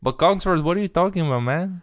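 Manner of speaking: high-pitched Mexican-accent impression; mock Mexican accent. Actor's character talking to Cogsworth, in a mock Mexican accent. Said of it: "That's what, what he sounds like".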